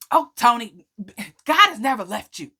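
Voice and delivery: a hint of an exasperated mother setting her straight; mock sassy mom; mom with attitude. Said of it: "she had a whole attitude".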